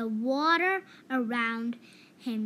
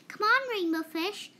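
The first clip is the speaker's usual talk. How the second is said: high, bright calling voice; 9 semitones higher, 4 dB louder than usual. A narrator's reading. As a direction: call out to a friend. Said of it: "they would call".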